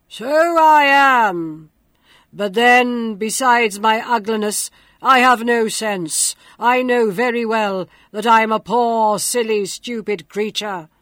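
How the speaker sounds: loud, booming beast voice; grotesque monster voice. A narrator's reading. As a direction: boom it out like a monster. The monster speaks.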